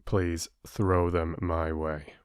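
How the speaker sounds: usual speaking voice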